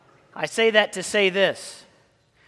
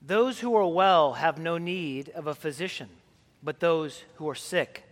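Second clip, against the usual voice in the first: solemn gospel reading; 3 semitones lower than usual. Acted. Priest reading the gospel to the congregation, solemn and measured. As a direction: read the gospel as at the altar, unhurried.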